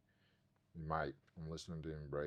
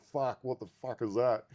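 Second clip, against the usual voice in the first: louder, higher, slowed startled cry; startled exclamation; 7 semitones higher, 11 dB louder, slower than usual. Retold or imitated. Seeing the old man by the road, a startled loud cry. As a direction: shout it out in shock.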